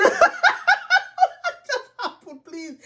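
very high, slow words through nervous laughing; fearful laughter. Real, not acted. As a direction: squeal it helplessly through frightened laughter. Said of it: "this is laughter of fear and nervousness".

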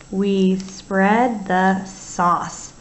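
stretched-out sentence for spelling; slow dictation. Shown as a demonstration. Teacher dictating slowly to young writers. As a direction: say it slowly so kids can write. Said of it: "you hear in the word sauce".

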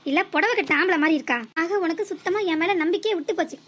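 ordinary talk, nothing marked

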